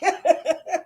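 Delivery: high amused laugh. Real, not acted. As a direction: break into a short laugh. Laughing at a pepper spray comment.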